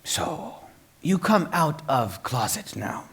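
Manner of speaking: a hint of an old Korean grandfather, slow; light imitation of an old grandfather. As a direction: slowly deliver the grandfather's deadpan line. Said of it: "And he goes".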